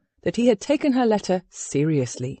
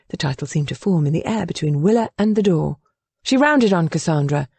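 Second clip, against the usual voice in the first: ordinary talk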